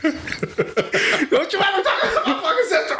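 high, slowed, laughing mock shout; mad yell. Tony yelling at his friend about his sister, mock furious shout. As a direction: bellow it like a furious big brother, half laughing. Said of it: "that's how mad he got".

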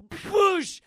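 loud high slow crash noise; shouted impact. Retold or imitated. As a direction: bellow the wallop. Motorcycle impact sound effect.

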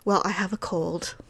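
wife imitates husband's meek confession; mock sick husband. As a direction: confess the cold meekly. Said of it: "He goes".

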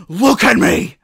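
mock-angry yell; retold shout. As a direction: burst out shouting like an exasperated boy. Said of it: "And he just shouts".

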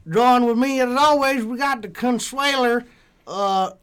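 put-on host the show like a good ol' country boy; country accent. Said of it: "every week you come up with a, with a different accent".

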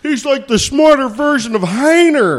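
put-on half-laughed Southern accent, pushed high. Retelling the bar stranger in a Southern accent, laughing. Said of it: "with a really big Southern accent".

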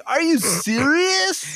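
high-pitched, drawn-out excited squeal; stretched, over-the-top amazed shriek; jazzed disbelief. Retold or imitated. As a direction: re-enact a thrilled, incredulous outburst way up high. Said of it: "I was so jazzed. I was like".

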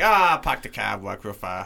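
hammed-up Boston accent. Shown as a demonstration. Suggesting another accent to the hosts, hammed-up Boston accent. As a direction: do a broad mock Boston accent. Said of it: "we could just go Boston".

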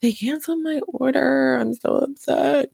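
high, slow, whiny complaint; a mom hamming up her tantrum about the canceled order, whiny; mock whining. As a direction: whine it like a kid throwing a tantrum. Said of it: "whine and complain and throw a tantrum".

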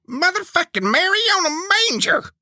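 very high, strained outburst; exasperated curse. Acted. Preacher cursing at being left behind, high exasperated outburst. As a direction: let the oath fly up high in exasperation.

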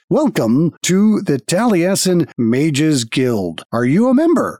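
slow, stiff robotic greeter voice; guild automaton. Acted. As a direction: speak like a polite machine at a desk.

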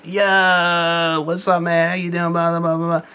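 high, drawn-out buddy greeting; imitated casual caller. Old contact phoning him up, hammed-up chummy greeting. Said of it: "Or they call and be like".

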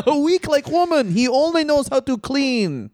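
hammed-up Russian accent; mock Russian strongman. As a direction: boast like a cartoon Russian heel. Continues Russian bit.

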